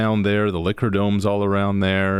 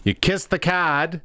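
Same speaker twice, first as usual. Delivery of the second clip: a high, lightly put-on Newfoundland lilt; a hammed-up bad Newfoundland accent, pitched up; terrible Newfoundland accent; 7 semitones higher than usual. Explaining the screech-in ritual to the host, in a bad Newfoundland accent. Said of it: "That was a terrible Newfoundland accent".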